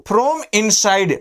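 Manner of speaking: slow, deliberate "from inside"; slow teaching model. Shown as a demonstration. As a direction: say it slowly for the class. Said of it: "तो इसको हम लोग बोलते हैं". (धीरे-धीरे "from inside")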